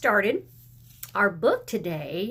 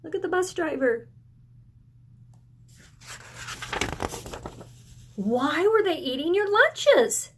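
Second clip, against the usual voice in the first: put-on excited child; 5 semitones higher than usual. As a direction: spill it out like an excited kid. Reading the dialogue again.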